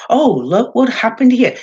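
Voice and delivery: high, loud mock gloat; hammed-up bragging, showing off the title. Bray mockingly gloating over a title he doesn't hold. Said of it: "He doesn't, you know".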